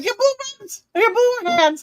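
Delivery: high-pitched silly squeal; goofy high voice; mock squeal. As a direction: squeak it out like a cartoon. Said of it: "And I'm like".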